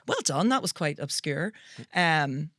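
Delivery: ordinary talk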